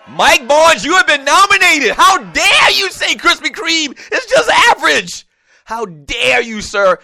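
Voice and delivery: loud, high, stretched indignation; mock outrage. Host faking outrage at a caller who dissed Krispy Kreme. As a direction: bellow it like you're scandalized.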